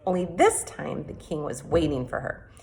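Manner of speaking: ordinary delivery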